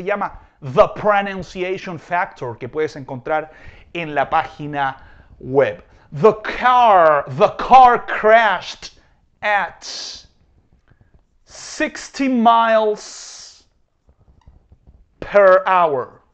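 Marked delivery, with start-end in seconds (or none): 6.1-16.2 slow and loud, the car stressed; slow model sentence. Shown as a demonstration. Teacher reading an example to students. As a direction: read it out slowly for the class. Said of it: "example sentence".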